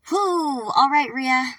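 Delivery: loud, high whoop to regroup; psyching oneself up. Real, not acted. Reader rallying before a hard choice, psyching up. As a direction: blow off nerves, loudly rallying. Psyching self up after shock.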